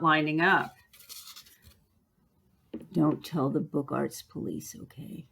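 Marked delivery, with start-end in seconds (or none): 2.9-5.3 conspiratorial hush. Acted. Teacher confiding a misaligned cover to viewers, hushed. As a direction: lower and softer, a secret shared.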